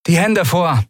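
high-pitched order; curt command. A narrator's reading. Young guard about to handcuff the leader, curt command. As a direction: order the captive sharply.

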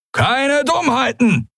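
high, slow threatening pirate. A narrator's reading. Halbhorn aiming his weapon at captives, threatening. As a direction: draw out a high-pitched warning. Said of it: "drohte er".

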